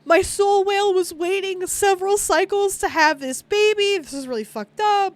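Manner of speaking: mock wail over the lost whale; upset mother. Mother grieving her killed soul whale, high and upset. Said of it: "she's super upset".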